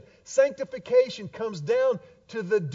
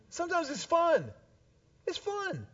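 teasing high; 9 semitones higher, 4 dB quieter than usual. Acted. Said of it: "teasing confession".

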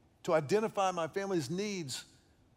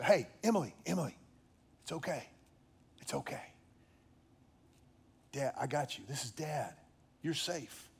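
softer, hushed reassurance dropping to a whisper; softer soothing near-whisper to a crying child; soothing comfort; 2 semitones lower, 5 dB quieter than usual. Retold or imitated. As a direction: soothe her back to calm. Father comforting crying daughter.

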